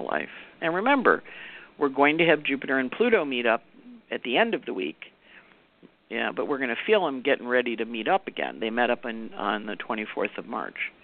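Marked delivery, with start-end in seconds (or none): none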